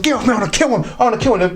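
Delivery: high, agitated retelling; mock panic at normal volume; panicked threat. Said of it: "and he panicked".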